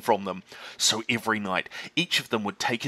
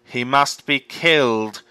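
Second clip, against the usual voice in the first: slow, louder cold verdict; grim farmer's cry; 7 dB louder, slower than usual. Acted. Bean declaring to the farmers, grim and slow. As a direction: pass sentence on the fox. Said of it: "cried Bean".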